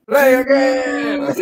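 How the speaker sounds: high, slow, loud pleading; whiny giant. Acted. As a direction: wail it like a sad giant. GM voices giant begging.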